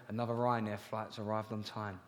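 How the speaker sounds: low smug intercom announcement; pilot voice. Retold or imitated. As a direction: play the Ryanair captain, pleased with himself.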